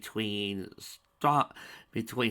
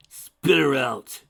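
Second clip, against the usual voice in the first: slow, much louder shout; 5 semitones higher, 12 dB louder, much slower than usual. A narrator's reading. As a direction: bellow it as a command. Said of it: "shouted Spike".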